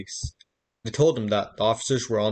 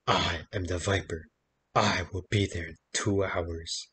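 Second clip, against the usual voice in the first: a lightly lowered, slow creepy caller; 4 semitones lower, 5 dB quieter than usual. A narrator's reading. Storyteller voicing a creepy phone caller, mildly menacing. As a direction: menace him gently down the phone. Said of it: "a creepy voice said".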